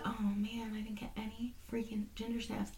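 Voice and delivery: whisper. Retold or imitated. Retold grumble to herself.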